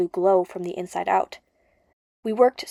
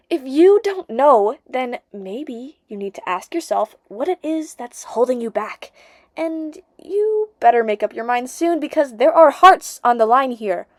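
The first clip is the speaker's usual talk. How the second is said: lightly voiced friend, a bit higher and pushing; Nissa, urgent friend; 5 semitones higher, 4 dB louder than usual. Friend urging the narrator about hearts on the line, higher and insistent. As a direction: press her to make up her mind.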